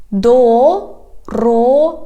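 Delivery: "Дорога" slow, in separate syllables. Shown as a demonstration. Teacher has learners repeat, "Дорога" slowly by syllables. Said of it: "divide it into syllables".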